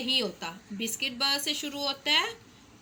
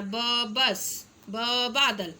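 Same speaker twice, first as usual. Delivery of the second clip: very slow, with ब drawn out before बस and बादल; stress on ब; much slower than usual. Shown as a demonstration. Teacher stressing the first sound ब for children, slowly. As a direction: linger on ब, then say the word. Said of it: "तो हमने ब पे ज़ोर देना".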